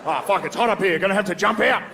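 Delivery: high, hammed-up Australian dismay; Australian accent. Australian trapped in a burning office, comically distressed Australian accent. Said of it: "an Australian guy in the office".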